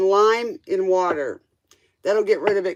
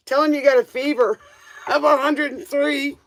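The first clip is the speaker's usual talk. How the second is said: laughing; 6 semitones higher than usual. Real, not acted. Suggesting a fake sick excuse.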